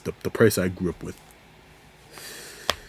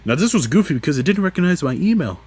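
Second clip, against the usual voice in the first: ordinary talk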